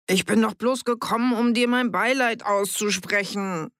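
hoch und weinerlich klagend; jammernd. A narrator's reading. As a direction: jammer dich mit Selbstmitleid heraus. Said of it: "jammerte Fritz". (Fritz jammert vor Annie, er wolle nur kondolieren)

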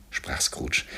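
usual speaking voice

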